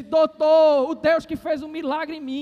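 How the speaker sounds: loud, high declaration; triumphant proclamation. Retold or imitated. Healed man telling the doctor of the miracle, loud triumphant proclamation. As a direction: open on a shout, then declare it high and firm.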